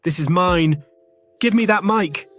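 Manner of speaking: mock screaming. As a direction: shout it like a possessive outburst. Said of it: "every inch of her body was screaming".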